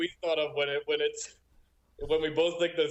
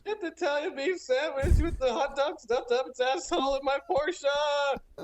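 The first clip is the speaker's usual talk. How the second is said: mock order; 10 semitones higher than usual. Mocking friend's food order.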